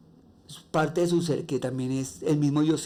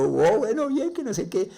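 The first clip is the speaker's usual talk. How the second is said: much higher, embarrassed fluster; flustered reaction; 7 semitones higher than usual. Retold or imitated. Greeted on the street by people who recognize his voice, flustered and high. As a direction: brush it off in a rising, flustered squeak.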